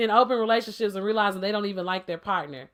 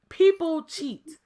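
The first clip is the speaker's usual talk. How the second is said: slow, weighty emphasis on "People"; "People" set out slowly and emphatically; stress on People; 3 semitones higher, much slower than usual. Real, not acted. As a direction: lean hard on the word "People", drawing the phrase out slowly. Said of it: "I put emphasis".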